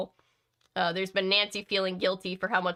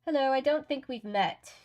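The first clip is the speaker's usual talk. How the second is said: higher, melodious put-on British accent; Fiona Fox, bad British accent; 6 semitones higher than usual. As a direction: greet them in a lilting, bad English accent. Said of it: "with her mel-, melodious British accent".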